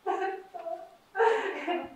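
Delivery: laughing through tears, voice breaking high; fighting back tears over her son; choked-up, tearful laugh; crying. Real, not acted. Mother recalling son's suicide.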